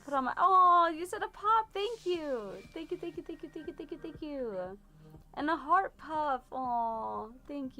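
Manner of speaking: high-pitched gushing thank yous; excited thanks. Real, not acted. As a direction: squeal thanks at a gift like it made your day. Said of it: "thanking viewers for gifts".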